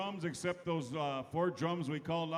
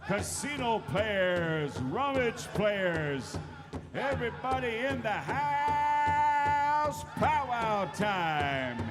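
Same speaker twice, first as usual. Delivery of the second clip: hype announcer; 6 semitones higher, 4 dB louder, slower than usual. Acted. Emcee hypes crowd to dance.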